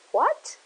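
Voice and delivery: "what" said as a sharp question, pitch jumping up high; rising what. Shown as a demonstration. Showing English speakers the rising tone with "what". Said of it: "in English to make you understand".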